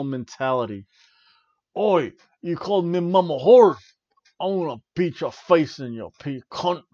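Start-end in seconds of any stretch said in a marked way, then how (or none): 1.8-6.8 a light mock English brawler, louder; English pub brawler. Imagining the Butcher at the pub, lightly voiced English brawler. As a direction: pick a pub fight in a hinted English accent. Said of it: "I could see him saying that".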